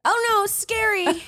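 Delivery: mock fear. Mocking fear.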